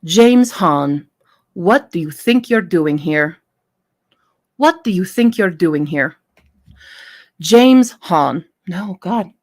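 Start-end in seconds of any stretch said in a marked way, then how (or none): 0.0-6.2 put-on a stab at Taliba's Middle Eastern accent, louder; Middle Eastern accent attempt. Taliba confronting James at her bunker, Middle Eastern accent attempt. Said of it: "I don't know how to do Taliba's accent". 7.4-8.4 put-on loud stab at a Middle Eastern accent; Middle Eastern accent attempt. Taliba greeting James, attempted Middle Eastern accent. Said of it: "I don't know how to do Taliba's accent".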